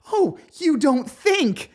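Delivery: high, loud, slow and biting; sharp hysterical. Acted. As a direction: spit it out near a wild laugh. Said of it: "The laugh that tears out of me is sharp".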